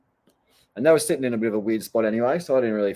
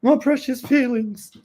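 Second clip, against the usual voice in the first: put-on very high, louder whiny cry-baby voice; mock whinging; 4 dB louder than usual. Surfer mimicking people who complain online, mock whinging. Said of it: "start whinging".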